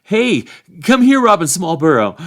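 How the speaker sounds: high, loud hail; calling out. A narrator's reading. Sam spotting a sheriff he knows, loud calling out. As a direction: shout to someone down the line. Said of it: "he called".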